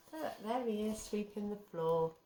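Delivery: plain reading voice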